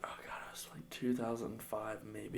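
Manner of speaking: thinking aloud. Real, not acted. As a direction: murmur it to yourself, nearly whispered. Thinking back to a year.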